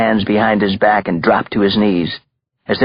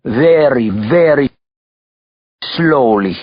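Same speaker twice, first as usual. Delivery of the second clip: put-on higher, drawn-out words with pauses, Russian accent; Russian mobster's order; 5 semitones higher, 3 dB louder, slower than usual. A narrator's reading. Belova warns his captive at gunpoint, ordering. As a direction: give each word its own weight, heavy Russian accent. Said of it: "ordered Ivan Belova".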